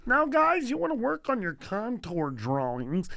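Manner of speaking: teacher's voice jumping up high at the start; art teacher impression. Teacher addresses his art class, imitated voice. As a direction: pitch up the call to the class, then settle. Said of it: "Was it doing his, his voice?".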